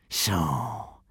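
low, soft hissed so. A narrator's reading. Alvin reveals himself to Hiccup, low soft hiss. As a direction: hiss it out with menace. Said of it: "hissed Alvin".

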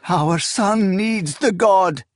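high, drawn-out acted sob; tearful wailing appeal; sobbing plea. A narrator's reading. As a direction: beg slowly through tears. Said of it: "she sobbed".

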